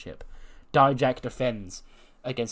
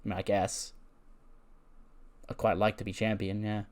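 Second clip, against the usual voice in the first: low, soft, noncommittal mumble; shrugging indifference; 3 semitones lower, 6 dB quieter than usual. Acted. Wrestler asked about a title shot, acting indifferent. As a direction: answer as if barely bothered. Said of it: "he just shrugs his shoulders".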